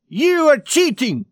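high, loud cry of outrage; shouted accusation. A narrator's reading. Player accuses another at the card table, shouted accusation.

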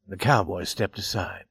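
plain reading voice

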